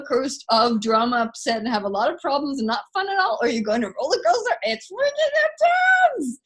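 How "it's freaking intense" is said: much higher, thrilled emphasis; excited. Real, not acted. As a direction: squeal out the thrill. Thrill of roller coaster.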